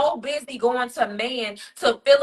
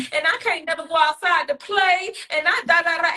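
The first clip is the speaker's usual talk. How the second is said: put-on higher little kid voice, whiny; higher complaining child's voice; upset child; 7 semitones higher than usual. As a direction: tattle like a small upset child. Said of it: "your child is like".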